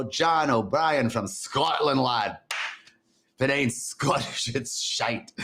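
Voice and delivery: playful, amateur Scottish accent. Shown as a demonstration. Greeting John from Scotland in a joking Scottish accent. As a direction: have a go at a Scottish lad's voice. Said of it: "do a Scottish accent".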